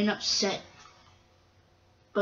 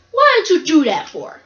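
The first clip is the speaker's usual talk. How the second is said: loud, high-pitched complaint; indignant protest; 6 semitones higher, 7 dB louder than usual. Acted. Freight cars complain after being bumped, indignant. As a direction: cry out in protest.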